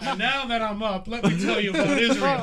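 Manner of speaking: loud, laughing rant opener; mock soapbox orator. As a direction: launch into a soapbox speech.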